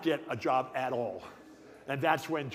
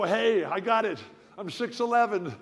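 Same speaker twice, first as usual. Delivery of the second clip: high, louder burst of mock inspiration; excited brainwave; 6 semitones higher, 5 dB louder than usual. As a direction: reel it off like a sudden bright idea. Acts out his sudden career idea.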